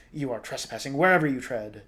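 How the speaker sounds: loud monster accusation; menacing Lubbock snarl, forceful; snarling creature. A narrator's reading. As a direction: snarl it loudly at the intruder. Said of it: "the Lubbock snarled".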